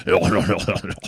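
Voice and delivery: low, wobbly jowl noise; comic low blubbering sound; imitated jowl wobble. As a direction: mimic flabby jowls shaking as you talk. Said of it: "That's what it sounds like".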